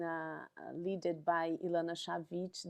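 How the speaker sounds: ordinary talk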